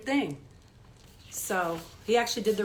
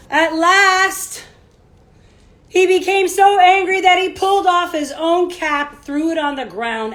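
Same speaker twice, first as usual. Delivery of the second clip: bright, louder storybook narration; reading to children; 7 semitones higher, 10 dB louder than usual. A narrator's reading. Teacher reading a picture book to children, lively storybook voice. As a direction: read it out big for the kids.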